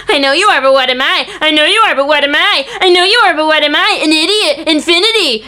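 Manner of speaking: movie quote impression. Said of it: "and then he goes".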